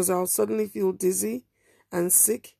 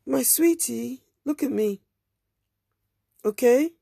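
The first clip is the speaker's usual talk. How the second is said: higher, gentle coaxing woman; a lightly voiced pleading stranger; 4 semitones higher, slower than usual. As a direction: coax the girl to look at you.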